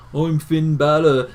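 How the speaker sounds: slow mock Irish accent; drawn-out caricature of an Irish accent; Finn Balor. Fan mocking an Irish wrestler introducing himself, exaggerated Irish accent. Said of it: "don't come out and be like".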